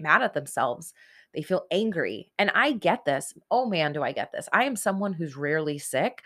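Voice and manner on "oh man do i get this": normal talking voice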